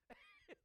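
amused laugh. Real, not acted. Laughing at koala story.

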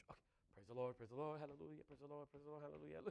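half-hearted hallelujahs, fading; mock tired praise. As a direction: drone it like a chore. Mock weary rote praise.

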